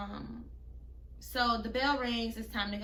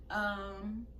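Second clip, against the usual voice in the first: ordinary talk, nothing marked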